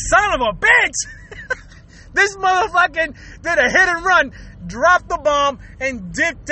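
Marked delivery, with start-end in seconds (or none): 0.1-1.6 slow, higher-pitched exasperated curse; mock outrage. Podcaster reacting to walking into someone's stink, mock outrage. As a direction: draw out the curse in comic indignation. Acted outrage at smell hitting.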